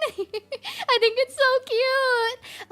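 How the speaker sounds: slow, high, loud gush with a laugh. Real, not acted. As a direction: melt over how cute it is. Gushing over country girl anime.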